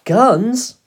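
high, loud cry of disbelief; shocked gasp. A narrator's reading. Boy hearing that the keepers are armed, shocked. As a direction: burst out in alarmed surprise. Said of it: "I gasped".

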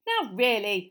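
loud, cross reproof; angry. A narrator's reading. Mister Weasley after the china figure is thrown, angrily. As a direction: scold with exasperated force. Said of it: "said Mister Weasley angrily".

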